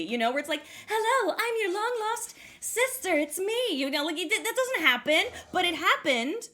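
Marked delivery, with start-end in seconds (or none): 0.9-3.6 high, sing-song hammed-up film voice; Barbie movie heroine. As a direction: greet like a cartoon long-lost sister. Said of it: "it's like a Barbie movie".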